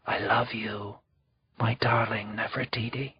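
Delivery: soft unvoiced whisper. A narrator's reading. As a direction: whisper tenderly to the dead baby. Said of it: "Ankhesenpa'aten whispered".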